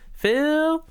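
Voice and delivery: high whiny call of a name; mock sister call. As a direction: voice the little sister calling out. Sister calling brother, high voice.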